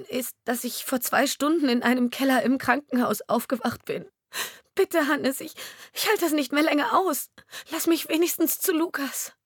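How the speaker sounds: high, pleading through tears; wife weeping and begging husband, high and tearful. Acted. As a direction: beg him, near breaking down. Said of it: "Sie fing an zu weinen".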